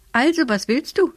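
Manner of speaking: high-pitched, mildly impatient question; lightly voiced impatient old woman. A narrator's reading. Old woman confronts the boy at her door, mildly impatient. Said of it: "fragte die Uralte ungeduldig".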